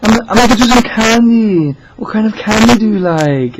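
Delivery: very high, loud mimicry; caller's put-on voice. Caller offering the manager candy, high loud put-on voice. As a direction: imitate an over-eager caller at top pitch. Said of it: "and he goes".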